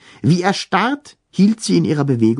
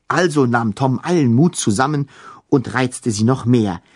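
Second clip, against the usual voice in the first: ordinary delivery, nothing marked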